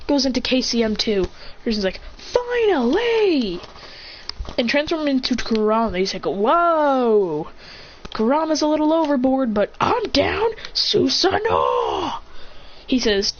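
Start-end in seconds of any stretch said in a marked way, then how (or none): 2.2-3.6 high, long-drawn cry of relish; gleeful drawn-out shout; exultant. Acted. Acted character relishing the fight. 6.3-12.2 high, eager voice ending in a drawn-out shout; excited battler. Acted. Acted excitement, calling out technique.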